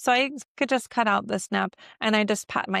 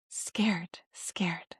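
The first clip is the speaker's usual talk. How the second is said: whispered, fading scared; 2 semitones lower, 7 dB quieter than usual. Shown as a demonstration. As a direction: hush it like you're frightened. Demo of scared sign.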